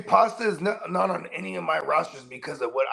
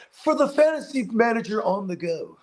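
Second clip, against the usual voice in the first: slow, raised pitchman parody; mock ad slogan; 5 semitones higher than usual. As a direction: sell it like a jokey commercial tagline. Jokingly voices a product slogan.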